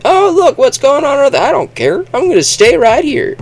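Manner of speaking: high, light mock voice; breezy I-don't-care voice; carefree settler. Settlers shrugging off Earth's war, high breezy voice. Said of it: "a handful of people said".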